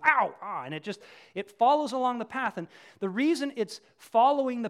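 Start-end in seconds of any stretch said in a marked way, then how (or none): 0.0-0.6 loud mock yelp of pain; imitated donkey "ow"; donkey's yelp. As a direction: cry out as the bridle jerks you back. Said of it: "you yank it, and it goes".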